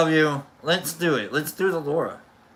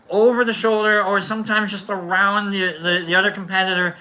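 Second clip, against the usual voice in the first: ordinary delivery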